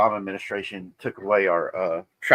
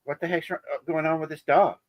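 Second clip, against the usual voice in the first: lightly voiced woman, higher and flustered; mock-panicked lady at the airport; a hint of a frantic woman whose bag the dog sniffed; panicked traveler; 5 semitones higher, 3 dB louder than usual. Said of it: "And she's like".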